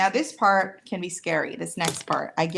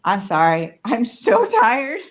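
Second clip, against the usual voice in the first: silly voice; 4 semitones higher, 7 dB louder, slower than usual. Pretend. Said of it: "I'm doing voices".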